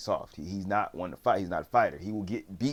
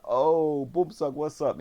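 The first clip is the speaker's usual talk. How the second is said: high, louder drawn-out hail; mock bully greeting; 7 semitones higher, 4 dB louder, slower than usual. Old bullies spotting Bumsuck, mock hearty greeting. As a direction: imitate a bully calling out to his target.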